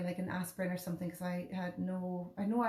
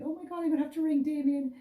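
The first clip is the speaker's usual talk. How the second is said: put-on much higher, louder scared little child; 8 semitones higher, 6 dB louder, faster than usual. Mother retelling her panic, voiced like a frightened little child. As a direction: panicky small kid needing help. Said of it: "like a little child then thinking".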